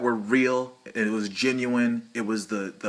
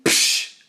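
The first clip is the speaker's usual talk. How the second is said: unvoiced scoff; 6 dB louder than usual. Scoffing dismissal.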